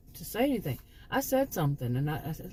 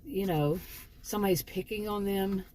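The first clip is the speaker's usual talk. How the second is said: normal talking voice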